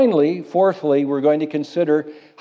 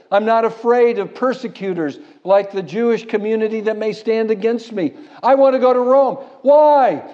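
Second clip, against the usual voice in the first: higher and louder, building to a cry; preacher voicing Paul, rising to a shout; bold preaching shout; 8 semitones higher, 4 dB louder than usual. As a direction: build Paul's defiance to a ringing climax. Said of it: "He says".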